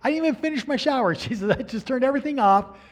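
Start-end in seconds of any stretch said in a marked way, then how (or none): 0.0-1.1 high, fast, loud retold protest; flustered protest. Retold protest at God's urgent command. 1.5-2.8 high, loud, mock-flustered report; flustered retelling. Retold flustered reaction to God's command.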